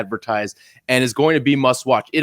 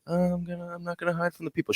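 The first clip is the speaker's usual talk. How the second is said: tiny, hushed, higher mumbling imitation; mock sheepish; 4 semitones higher, 12 dB quieter, faster than usual. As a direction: mimic the co-host shrinking away, very softly. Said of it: "the most sheepish I've heard you sound".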